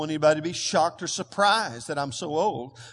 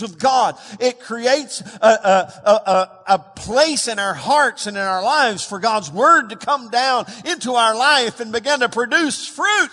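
rising, louder preaching build; 5 semitones higher, 7 dB louder than usual. Acted. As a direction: drive the point home from the pulpit.

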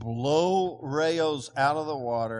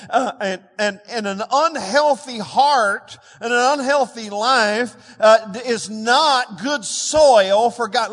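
louder, fervent pulpit voice; preaching; 5 semitones higher, 6 dB louder than usual. Acted. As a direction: preach it out with rising force. Preacher quoting John fourteen.

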